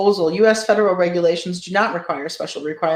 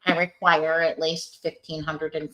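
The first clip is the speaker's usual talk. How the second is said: nerdy voice. Pretend. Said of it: "read it in, like, a nerdy voice".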